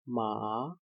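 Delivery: "Ma" clearly rising; second tone ma. Shown as a demonstration. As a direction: raise the voice on "Ma". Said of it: "You have to raise your voice".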